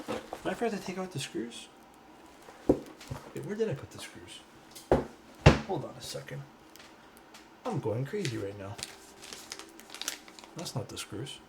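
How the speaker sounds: muttered search. Real, not acted. Searching for missing screws.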